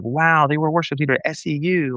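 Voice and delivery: high-pitched mock awe; mock impressed. Imitating someone wowed by a worship leader, mock impressed.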